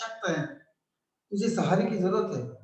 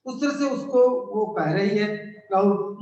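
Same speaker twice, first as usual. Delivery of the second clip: ordinary talk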